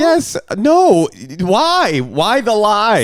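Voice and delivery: incredulous. Real, not acted. Objects loudly to lying.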